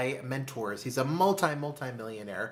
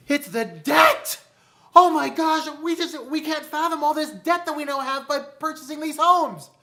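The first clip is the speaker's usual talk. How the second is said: high, loud wail of despair; hammed-up hysterical outburst, pitched way up; mock panic; 12 semitones higher, 7 dB louder than usual. Couple wailing to mentor about their debt, mock panic.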